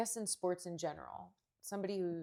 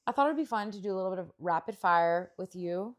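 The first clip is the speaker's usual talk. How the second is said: ordinary talk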